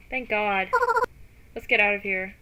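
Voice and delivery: ordinary talk, nothing marked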